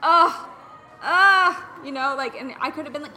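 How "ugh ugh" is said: loud, high, exaggerated "ugh"; mock groan. Host parodies negative sympathy over a swollen knee, loud mock groan. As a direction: groan in put-on commiseration.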